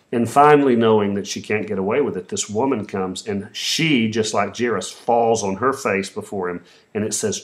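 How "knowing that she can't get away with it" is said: normal talking voice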